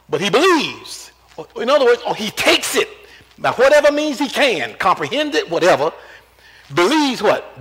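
rising preacher's fervor; full force preaching. Acted. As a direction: preach it hard to the congregation.

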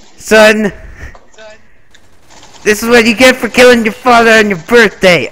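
high, loud hammed-up threat; mock fury. Father threatens his game son, hammed-up shout. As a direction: roar at the son in mock vengeance.

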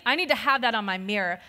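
usual speaking voice

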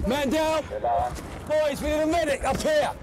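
shouting. Real, not acted. Calling for a medic under fire.

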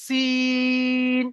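সিন with the vowel held three alif long; long drawn vowel. Shown as a demonstration. Teacher modelling a long সিন for the class. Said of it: "তিন আলিফ পরিমাণ টান আছে".

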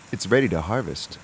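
ordinary delivery

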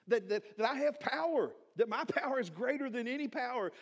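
God demanding to know why they still doubt him; voicing God's exasperation with his people, a little hammed-up; exasperated God. God's frustration voiced by preacher.

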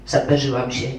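plain reading voice

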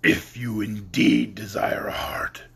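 gruff and slow, a growl in the voice; slow gruff monster voice; gruff beast. Acted. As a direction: lay down the terms like a growling monster. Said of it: "but said gruffly".

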